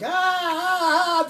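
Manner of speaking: short high mock cries of pain; imitated screams. Veteran imitating enemy screams heard from afar, mock scream. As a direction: imitate distant screaming, half-hearted. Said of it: "good screaming".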